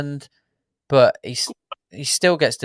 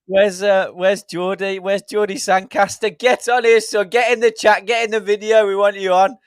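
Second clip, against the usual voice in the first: excited calling out; 8 semitones higher than usual. Real, not acted. Host calls out to a chat viewer after the goal, excited hollering. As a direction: shout for the viewer to join in.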